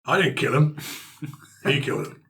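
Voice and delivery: usual speaking voice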